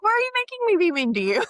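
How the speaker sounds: high, fast exasperated comeback; high mock comeback to a whiny client; mock retort. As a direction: fire it straight back at them. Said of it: "I'm like".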